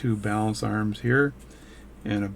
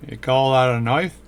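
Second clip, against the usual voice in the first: put-on Australian accent, louder; playful fake Australian accent, projected; 3 semitones higher, 4 dB louder than usual. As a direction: lay on a mock Aussie accent. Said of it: "speak with an Australian accent".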